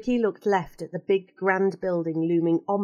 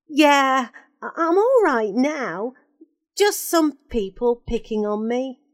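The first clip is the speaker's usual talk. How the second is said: put-on a boy's voice, higher, hesitant; nervous lad asking, pitched up; unsure boy; 5 semitones higher, 3 dB louder than usual. Ricky nervously greets the crying woman, unsure boy.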